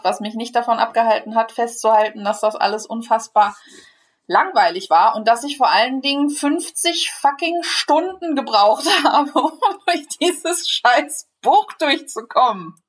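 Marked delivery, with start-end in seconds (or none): 5.1-12.8 higher-pitched, emphatic exasperation with a laugh; exasperated venting. Real, not acted. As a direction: swear through it in amused despair. Venting about fifty hours of proofreading.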